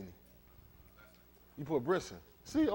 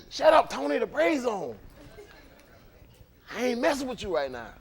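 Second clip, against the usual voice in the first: mock annoyed wife; 8 semitones higher than usual. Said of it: "But she".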